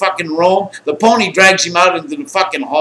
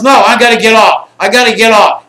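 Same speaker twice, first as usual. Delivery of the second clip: loud, raised-pitch insistent shout; acted loud refusal; 6 semitones higher, 8 dB louder than usual. Packer refuses his friend's plea, acted insistent shout. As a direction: shout it back, refusing to stop.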